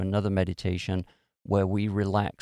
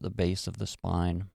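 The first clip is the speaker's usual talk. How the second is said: calm, measured meditation guide; guided meditation. Real, not acted. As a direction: ease them into relaxation with long pauses between phrases. Leads a guided relaxation meditation.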